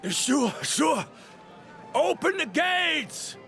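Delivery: high-pitched, eager call; calling out an order. Acted. A guard agreeing to open the gates, calling out. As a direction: call up to the gatekeepers.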